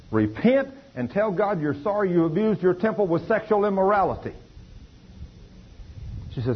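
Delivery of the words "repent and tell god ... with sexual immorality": much higher, forceful call to repent; preaching command. Retold or imitated. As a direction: thunder the call like a revival preacher. Preacher thunders at the mother.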